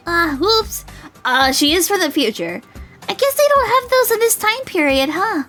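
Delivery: put-on high girl caught in a slip; flustered dandelion girl. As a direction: squeak it out like an embarrassed girl.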